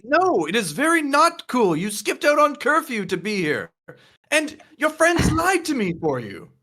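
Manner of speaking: high-pitched, emphatic telling-off; exasperated guardian reprimand; stern scolding. Acted. Tina scolds Alice for skipping out.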